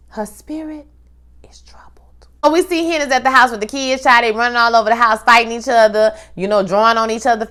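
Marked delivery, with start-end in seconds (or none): 0.1-1.8 whispered "Her spirit is troubled", much softer; full whisper, "spirit" then "troubled" fading. Real, not acted. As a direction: drop to a breathy whisper on "spirit". Repeating it more hushed.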